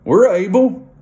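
eager. Retold or imitated. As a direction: answer boldly and a bit too fast. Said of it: "The sons of thunder then respond with".

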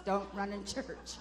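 slow, mock-stern rule for kids; scolding parent. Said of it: "We teach our kids".

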